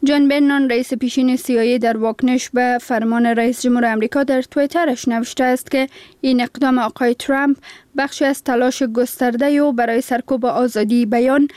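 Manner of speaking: ordinary talk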